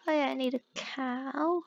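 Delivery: usual speaking voice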